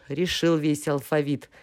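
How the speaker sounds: ordinary delivery, nothing marked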